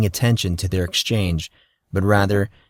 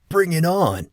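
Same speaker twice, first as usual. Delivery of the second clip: higher, fired-up challenge; riled up; 9 semitones higher than usual. A narrator's reading. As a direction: throw down the gauntlet. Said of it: "Casey also sounded riled up".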